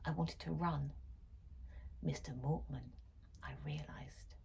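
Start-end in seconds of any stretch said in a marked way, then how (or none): 2.0-4.4 fading to a low whisper; chilling whispered reveal; dread reveal. A narrator's reading. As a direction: let it sink, lower and softer.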